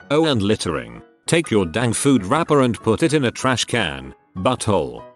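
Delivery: ordinary delivery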